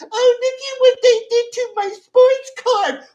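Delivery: high, loud, whiny mocking voice; mock whiny crybaby. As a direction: whine it like a crybaby over his card.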